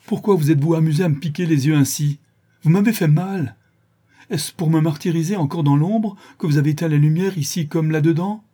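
loud, high-pitched indignation; angry protest. Acted. Blinded brigand accusing his captors, angry. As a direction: protest furiously at being hurt.

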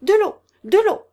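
high, loud cry of dread; horrified cry. A narrator's reading. Woman calls for water for the sick man, horrified cry. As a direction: call out urgently in terror. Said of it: "fit-elle d'un ton d'épouvante".

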